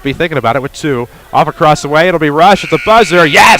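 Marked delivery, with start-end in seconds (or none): 1.3-3.6 loud, high buzzer-beater call; excited. Real, not acted. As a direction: yell it as the shot drops. Buzzer-beater three-pointer call.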